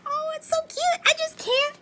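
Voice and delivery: squealing high, gushing over cute planners; gushing over something cute. Retold or imitated. Imitating a fan who spots cute planners everywhere, high squeal. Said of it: "you're like".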